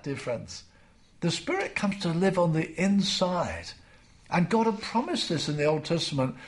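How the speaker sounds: ordinary talk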